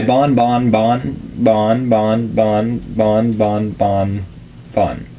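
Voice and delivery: slow, stretched repeating; drawn out. Real, not acted. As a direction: drag the name out.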